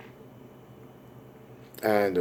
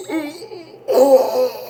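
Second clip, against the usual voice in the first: high breath swelling into a long groan; dying clown's moan. Acted. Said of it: "He's like".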